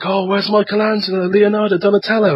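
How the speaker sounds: put-on high-pitched, eager kid asking; excited child fan. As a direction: play a little kid hunting for heroes. Voices himself as excited child fan.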